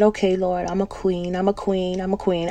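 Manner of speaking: saying it without believing it; quiet, unconvinced surrender to God; reluctant, half-believing. Retold or imitated. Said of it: "It wasn't in my spirit yet".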